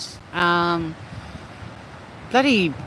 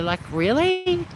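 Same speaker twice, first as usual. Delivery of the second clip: incredulous; 12 semitones higher, slower than usual. Real, not acted. Mocking people who trash Australia.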